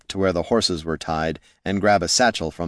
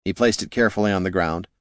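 plain reading voice

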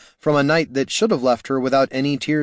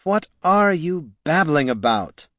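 mildly barked, higher-pitched question; 4 semitones higher than usual. A narrator's reading. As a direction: bark it with some impatience. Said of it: "barked the wizard".

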